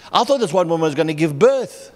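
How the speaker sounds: ordinary delivery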